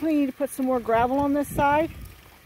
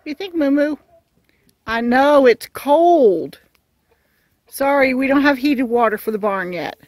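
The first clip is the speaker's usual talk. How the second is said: louder, apologetic call; sympathetic call to goat; 7 dB louder than usual. Real, not acted. Owner apologising to goat Moo Moo for cold water, louder sympathetic call.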